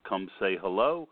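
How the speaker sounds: ordinary delivery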